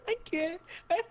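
high, laughing too hard to talk; helpless laughter. Real, not acted. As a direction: squeeze it out through real laughter. Overcome by laughter at own story.

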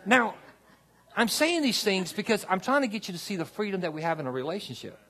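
impassioned pastor rousing the church; full-force preaching. Acted. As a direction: preach it out to the back row.

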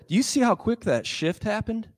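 ordinary talk, nothing marked

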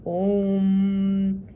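long low drawn-out monster moan; spooky moan. A narrator's reading. Chet moaning from the reeds as the Creek Devil, eerie moan. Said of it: "he started to moan, low at first and then louder".

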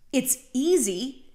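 ordinary delivery

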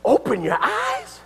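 high, rising echo in disbelief; incredulous question. Acted. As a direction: repeat it back as a baffled question. Incredulously repeats Solomon's demand.

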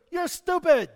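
high, loud insult; angry yell. Acted. Angry boy yells at the echo. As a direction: spit it out in anger. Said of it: "He's very angry".

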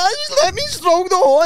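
very high, pleading mock wail; imitated crying. Drunk self begs police to stroke the horse, imitated crying. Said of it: "So I burst out crying again".

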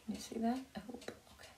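soft unvoiced aside; whispered aside. Real, not acted. Teacher muttering to herself while showing the book, whispered. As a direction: whisper it to yourself. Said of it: "aside to viewers".

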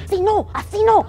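imitating Facilé. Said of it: "la Facilé dice".

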